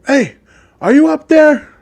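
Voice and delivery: high-pitched call up a tree. Acted. Police officer hailing a fugitive in a tree, calling up. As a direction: call up to someone hidden overhead.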